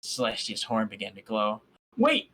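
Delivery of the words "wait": sudden call. Acted. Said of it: "I quickly stopped her".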